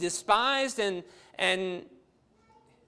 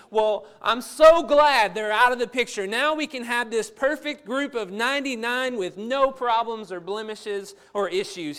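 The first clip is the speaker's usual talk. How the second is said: much louder, bright and sarcastic; mock relief; 4 semitones higher, 7 dB louder than usual. Preacher mocking a shepherd glad the stray is gone, loud and sarcastic. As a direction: voice the glib relief God does not feel. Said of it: "He's not thinking".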